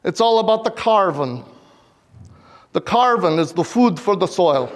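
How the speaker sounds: put-on thick Spanish-accented English. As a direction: imitate a Chilean friend in a heavy Spanish accent. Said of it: "in this thick Spanish accent".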